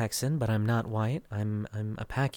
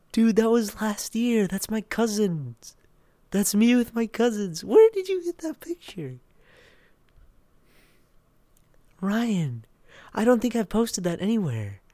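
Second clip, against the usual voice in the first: shocked protest; 11 semitones higher, 7 dB louder than usual. Real, not acted. Streamer questions friend about photo with cousins, shocked. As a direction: protest in a high, startled voice.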